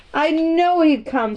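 mildly triumphant Yorkshire maid; exultant. A narrator's reading. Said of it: "said Martha exultantly".

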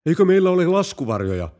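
a general in sudden terror; horrified. Acted. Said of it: "kenraali kauhistui". (kauhistunut, kovempi ja korkeampi kysymys)

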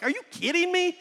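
retold outburst of disbelief; outraged disbelief. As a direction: younger brother protesting the unfair treatment. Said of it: "And I'm like".

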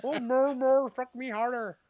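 high, drawn-out fake ecstasy; overacted lusty wail; mock porn moaning. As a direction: ham up a slow, pleading porn cry. Repeats the mock porn clip.

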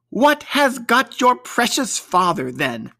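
a bustling mother scolding the late ones; Mrs Cratchit. A narrator's reading. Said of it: "said Missus Cratchit".

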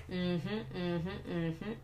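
soft, rising mm-hmms held back under the breath; muted mm-hmms. Shown as a demonstration. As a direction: swallow your anger and just murmur along. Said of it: "But I just go like this".

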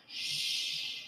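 soft, long shush; hushing shush. Retold or imitated. Rob signalling Tim to keep quiet, soft shush. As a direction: hush someone conspiratorially. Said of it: "like he's going".

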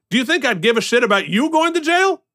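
ordinary talk, nothing marked

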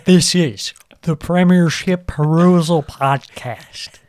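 show announcer. Acted. Hosts announce the show name.

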